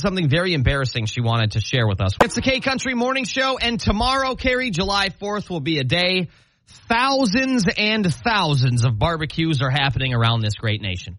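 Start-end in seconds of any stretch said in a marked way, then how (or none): 2.2-11.2 radio announcer. Acted. Radio host segment tease.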